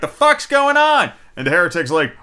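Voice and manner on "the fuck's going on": high, loud startled mock Talia; shocked outburst. Talia walking in on the clone, high shocked yell. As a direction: pitch it way up in mock alarm. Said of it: "she's like".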